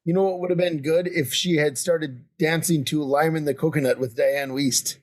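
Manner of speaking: normal talking voice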